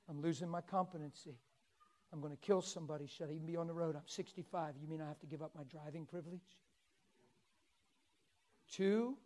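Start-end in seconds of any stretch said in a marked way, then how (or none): 0.1-6.6 tag. Retold or imitated. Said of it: "guess what she's thinking?".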